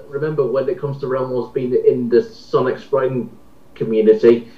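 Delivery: ordinary delivery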